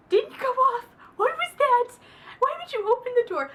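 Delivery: very high, loud frantic yelling; yelling at character. Retold or imitated. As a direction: scream at her not to open it. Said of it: "the whole time I'm thinking".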